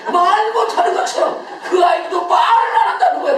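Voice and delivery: loud, high-pitched sermon delivery; forceful preaching. Real, not acted. Preacher to a silent congregation about the mute son, forceful preaching. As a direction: drive it home loudly to the congregation.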